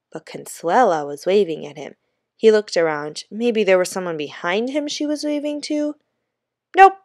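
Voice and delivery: plain reading voice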